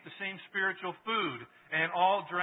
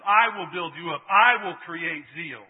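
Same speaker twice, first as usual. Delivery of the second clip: loud, slow proclamation of I will build you up; Jesus proclaiming; 2 semitones higher, 13 dB louder than usual. Acted. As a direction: boom out each promise slowly. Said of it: "And Jesus says".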